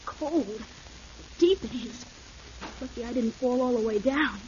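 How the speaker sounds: far off in a crevice. Acted. Boy fallen into the crevice.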